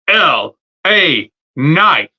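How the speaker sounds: slow, separated words, each one stretched; imitated wrestler's slow self-announcement; drawn-out catchphrase. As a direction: deliver the name slowly as a boast. Acting out the wrestler's catchphrase boast.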